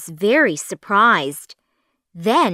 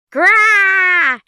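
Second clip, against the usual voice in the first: much higher, loud zombie shriek; furious zombie scream; 10 semitones higher, 7 dB louder than usual. A narrator's reading. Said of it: "she screamed".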